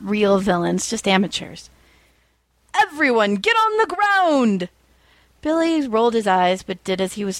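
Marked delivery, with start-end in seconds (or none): 2.7-4.7 higher, slow hammed-up order; mock bandit command. A narrator's reading. Amateur bandit ordering the bank customers down, high and slow.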